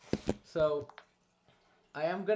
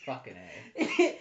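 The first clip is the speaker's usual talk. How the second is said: drawn-out curse; 7 semitones lower, 5 dB louder, much slower than usual. Real, not acted. Player reacts to roll.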